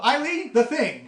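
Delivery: high, loud shout to an assistant; calling out. Acted. Boss yells to assistant to work the projector, calling out. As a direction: call across the room, pitched high. Game master's character calls an assistant.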